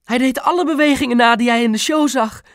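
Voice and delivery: high, loud, excited mother; enthusiastic chatter. A narrator's reading. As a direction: gush proudly in a high voice. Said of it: "snaterde Mam enthousiast". (enthousiast snaterend)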